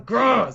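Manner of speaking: loud, high growl of fake rage; mock angry growl. Host reading angry fan letter, mock emotional growl. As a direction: growl like the letter made you furious. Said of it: "I became a little emotional here".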